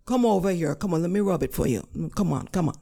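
coaxing Jamaican nurse, back at normal pitch; softened Jamaican-accented comforting; thick Jamaican accent. Retold or imitated. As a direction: coax the patient over in Jamaican. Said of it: "in a thick Jamaican accent".

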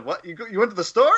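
high-pitched incredulous echo question; Jeff Garland impression. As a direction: imitate a comic asking back in disbelief, rising. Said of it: "the Jeff Garland".

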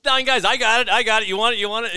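mock PA shout pumping up the crowd; hype announcer call. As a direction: belt it like an arena hype man. Mimics hype intro calling.

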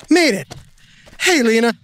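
panting after the climb; breathless arrival; out of breath. Acted. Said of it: "Give me a second to catch my breath".

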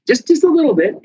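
tiny amount. Retold or imitated. Telling people bones bend just a little, in a high squeak. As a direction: pitch jumps way up on just a little bit.

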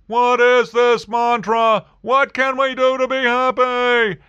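very high, loud, drawn-out mock begging; hammed-up pleading. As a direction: beg for the secret in a slow, high wail. Acting out the eager question.

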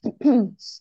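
hoarse clearing of the throat. Real, not acted. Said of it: "Losing my voice".

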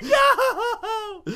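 high laughing fake wail; mock scream. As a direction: cry out with a laugh. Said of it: "She's like".

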